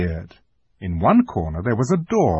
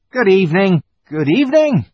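high, loud, flustered fawn; fawn's greeting; 9 semitones higher, 6 dB louder than usual. A narrator's reading. Fawn answers Lucy's greeting, high flustered voice. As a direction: greet loudly in a high creature voice. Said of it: "said the fawn".

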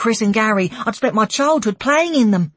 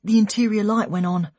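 usual speaking voice

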